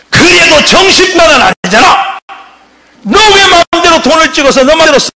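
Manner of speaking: high, loud accusing shout; angry confrontation. Retold or imitated. The elder shouts at Pak Hon-yong over the counterfeit money, angry. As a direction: pin him down with the charge.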